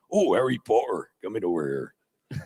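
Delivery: lightly voiced Scottish Hagrid voice; a hint of Coltrane's Hagrid, morning-hoarse; slight Scottish Hagrid impression; Robbie Coltrane impression. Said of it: "That's my new Robbie Coltrane".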